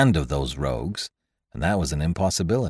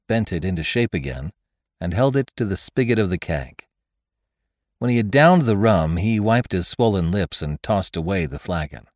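ordinary delivery, nothing marked

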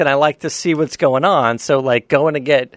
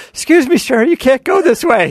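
put-on higher, sweet little old lady voice; polite old woman; 10 semitones higher than usual. Old woman in her car politely correcting the driver, high and sweet. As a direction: play the meek old woman asking nicely.

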